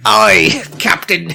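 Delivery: slow, hammed-up English accent; bad English pirate voice; joking English accent; mock English pirate. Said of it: "now you went from Australian to English".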